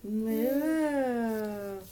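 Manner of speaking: cow moo. Acted. Parent echoes cow sound.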